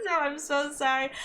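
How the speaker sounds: high, giggly apology; laughing apology. Real, not acted. Player to viewers after gushing over Fujieda, laughing apology. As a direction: apologise through embarrassed laughter.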